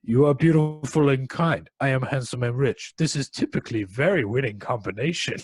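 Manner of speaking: a loud, hammed-up Russian accent for the suave suitor; a Russian playboy wooing in a mock Russian accent. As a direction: play the smug Russian charmer in a thick Russian accent. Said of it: "in his Russian accent".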